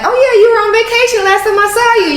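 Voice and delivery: high, bright mock-surprised quote; retelling her own surprise. Host retelling meeting guest, high mock surprise. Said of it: "I'm like".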